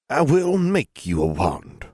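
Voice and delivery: put-on slow, solemn old woman; old granny voice. A narrator's reading. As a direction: pronounce it gravely like an elder.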